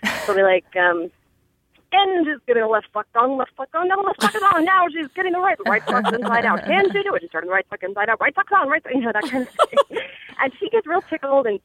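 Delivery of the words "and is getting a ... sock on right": rapid auctioneer patter calling her progress. Shown as a demonstration. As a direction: rattle it off like an auctioneer. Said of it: "do, like, an auctioneer voice".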